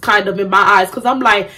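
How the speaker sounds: ordinary talk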